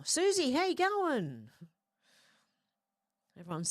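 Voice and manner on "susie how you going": excited greeting. Real, not acted. Greeting a viewer by name.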